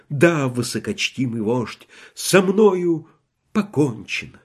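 ordinary delivery

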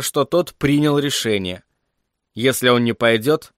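plain reading voice

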